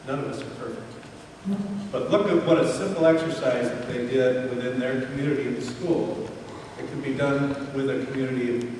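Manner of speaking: ordinary talk, nothing marked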